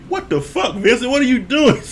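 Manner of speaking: high-pitched mock bafflement; imitating stunned experts; baffled disbelief. Retelling the experts' baffled reaction.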